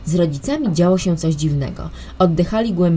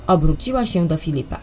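ordinary delivery, nothing marked

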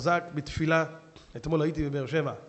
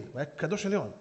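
normal talking voice

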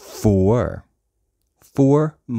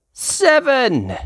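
higher, louder reveal; excited reveal; 13 semitones higher, 5 dB louder than usual. Acted. Reader revealing the geese, excited. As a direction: announce it with delight. Climactic count.

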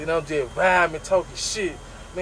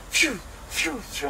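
whooshing car; 9 semitones higher, 6 dB quieter, slower than usual. Retold or imitated. Car flying past sound.

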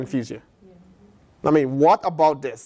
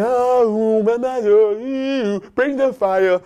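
lightly hammed-up priests calling for fire; a touch of a chanting priest; mock priests' plea; 6 semitones higher, much slower than usual. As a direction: mimic a pagan priest pleading.

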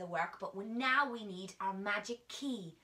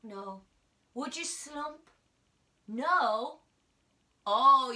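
ordinary delivery

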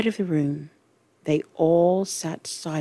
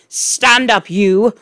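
loud, raised command; shouted order; 4 semitones higher, 6 dB louder, slower than usual. A narrator's reading. Trunchbull ordering Wilfred to stand, shouting. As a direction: shout it like a tyrant headmistress. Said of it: "she shouted at him".

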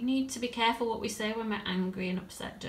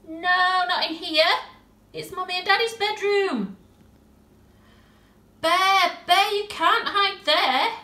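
much higher and louder on No, not in here; bossy girl, rising alarm; 8 semitones higher, 9 dB louder than usual. Acted. Tilly stops the bear hiding in the wrong room, alarmed. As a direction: shoo him off in panic.

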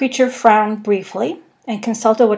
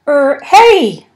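loud, high call after a leaving ship; shout; 5 semitones higher, 8 dB louder than usual. A narrator's reading. Arthur shouts after the departing alien ship. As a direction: yell hey to stop them going. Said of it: "shouted Arthur".

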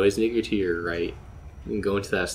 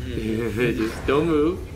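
slow drawn-out; 2 semitones higher, much slower than usual. Real, not acted. Drawn-out coaching.

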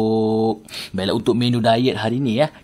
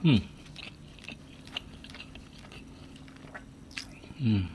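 humming with closed mouth; muffled mm while eating; mouth full chewing; 14 dB quieter than usual. Real, not acted. Seen 3: Food put in mouth at -4, closed lips, jaw chewing throughout.

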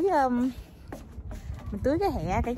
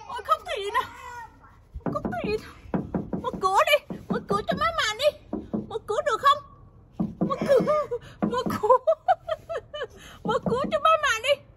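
high coaxing; 11 semitones higher, 4 dB louder than usual. Real, not acted. Mother asks crying child to open the door, high coaxing. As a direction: coax a crying little one, pitched way up.

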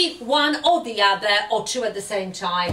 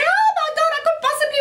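high squeal of delight; excited daughter; 3 dB louder, much faster than usual. Retold or imitated. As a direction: gush like a thrilled teen. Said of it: "Misha's gonna say".

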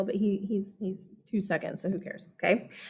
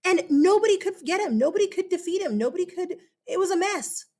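excited; 7 semitones higher, faster than usual. Acted. Teacher dramatizing Spartacus story.

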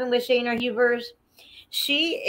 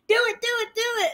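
high-pitched imitated begging; mock panicked pleading; 12 semitones higher, much faster than usual. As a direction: imitate a brother urging frantically, high. Retold brother begging her to pop.

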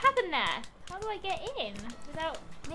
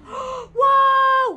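loud, high whoa; shouting whoa mid-flight; excited shout; 8 semitones higher, 12 dB louder than usual. Real, not acted. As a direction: yell with thrill as you fly. Frog launched through the air.

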